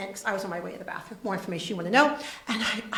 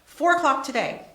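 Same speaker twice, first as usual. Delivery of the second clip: louder, higher urgent demand; urgent answer; 7 semitones higher, 10 dB louder than usual. Retold or imitated. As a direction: insist on the deadline. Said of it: "Sherry answering Debbie".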